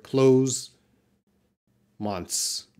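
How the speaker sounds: "close" and "Months" with the TH dropped; cheat pronunciation. Shown as a demonstration. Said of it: "just say the same sound as this word".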